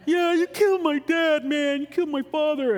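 high, loud, whiny mock crying; whiny crybaby Luke. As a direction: wail it like an overdramatic crybaby. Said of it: "whiny kind of crybaby Luke".

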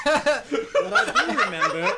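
loud, high mock dragon voice; the owl mimicking the dragon, high and loud. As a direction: puff up and ape the dragon. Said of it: "change my voice so it sounds exactly like the dragon".

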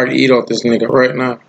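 ordinary talk

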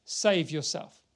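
a touch of mockery; lightly taunting, rising on the king; mocking soldiers read. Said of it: "The soldiers mocked him".

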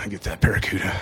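lightly voiced low, gruff biker; a hint of a tough biker ordering discreetly; manly biker. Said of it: "this big, manly biker would come in".

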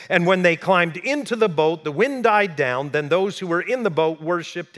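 fired-up sermon delivery; preaching at full force. Acted. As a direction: preach it out to the room. Preaching scripture story.

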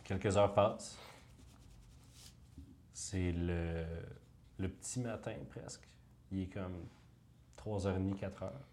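soft, hushed scene setting; game master narration. Acted.

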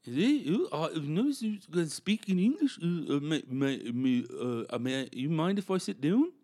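the Finnish dude asking to sit down, in a halting mock Finnish accent. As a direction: stammer like a Finn hunting for English words. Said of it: "a Finnish dude, uh, rolled up to us".

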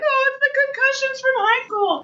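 high, whiny mock excuse. Host mimicking someone blaming concussions, high mock excuse. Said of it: "and then just be like".

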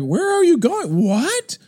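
high, loud alarmed questioning; acted-out commotion. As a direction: call after someone leaving in bewilderment. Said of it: "I hear this commotion".

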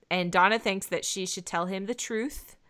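ordinary talk

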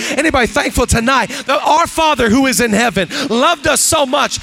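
loud, rising preacher's cadence; full-force preaching. Acted. Pastor preaching to the church, loud and building. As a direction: preach it out to the congregation.

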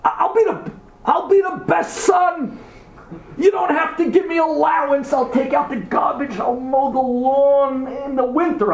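put-on high-pitched, eager child begging to be picked; high, insistent begging; pleading orphan screaming. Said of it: "each kid is screaming".

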